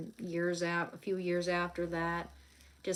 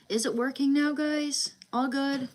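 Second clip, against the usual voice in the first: higher, louder call to chat; checking the stream; 7 semitones higher, 7 dB louder than usual. Real, not acted. Asking viewers if the stream works, raised voice. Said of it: "our connection got messed up".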